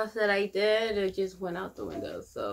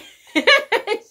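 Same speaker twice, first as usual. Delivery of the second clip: loud, high shriek of a laugh; real giggling fit; high burst of laughter; 15 dB louder than usual. Real, not acted. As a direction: crack up in a high squeal. Laughs at own impression.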